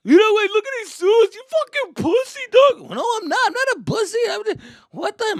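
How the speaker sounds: high, loud teasing squawk; mocking taunt. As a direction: taunt him like a bratty cousin. Cousin taunting, kid denying.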